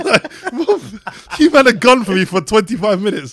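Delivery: high, loud mock outrage through laughter; laughing protest. Real, not acted. Friend accused of crying, protesting loudly while laughing. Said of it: "Look at his crying".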